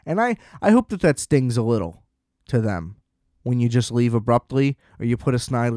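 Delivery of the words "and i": ordinary talk, nothing marked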